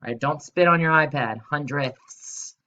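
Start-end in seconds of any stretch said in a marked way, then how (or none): 1.4-2.6 Hundredths with the final s stretched into a hiss; a lightly played snake hiss. Shown as a demonstration. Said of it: "in your best snake voice".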